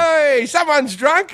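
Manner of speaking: high, slow, drawn-out merry call; happy cheer. Retold or imitated. Comic re-enacting his welcome to a drunk stranger, happy cheer. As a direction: cheerily announce the drunk to the room. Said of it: "like a, like a happy".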